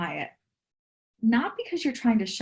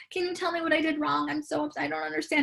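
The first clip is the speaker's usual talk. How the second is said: higher, pleading mock girlfriend voice; imitating anxious girlfriend; 6 semitones higher than usual. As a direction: play her up as hurt and confused. Anxious partner voiced in story.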